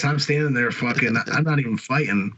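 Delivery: ordinary talk, nothing marked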